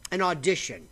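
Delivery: ordinary delivery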